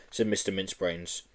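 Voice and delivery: usual speaking voice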